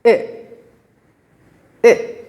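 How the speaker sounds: I clipped as a short ih; short i sound. Shown as a demonstration. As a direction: keep the I short and quick. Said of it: "but a short sound".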